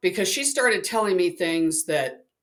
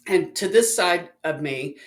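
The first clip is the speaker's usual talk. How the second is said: usual speaking voice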